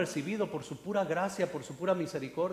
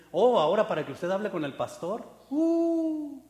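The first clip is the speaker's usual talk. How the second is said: haughty gatekeeper; 4 semitones higher, 5 dB louder than usual. Retold or imitated. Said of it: "ya sienten".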